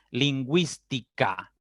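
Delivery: "Lingüística" slowly as lin-gwis-ti-ca; sounded U. Shown as a demonstration. Teacher gives students a dieresis example, slow syllables, U sounded. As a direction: spell out "Lingüística" by syllable, W glide clear. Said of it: "La U se pronuncia".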